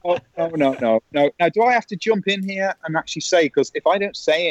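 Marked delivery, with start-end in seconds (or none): 0.0-1.3 drawn-out string of sinking no's; retold dismay. Presenter left with nothing to discuss, mock dismay. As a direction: imitate mounting dread at a useless answer. Re-enacts dismay at the short answer.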